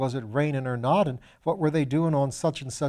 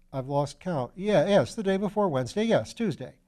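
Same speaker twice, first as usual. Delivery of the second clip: put-on young student; 6 semitones higher than usual. Piggott voiced as a young student.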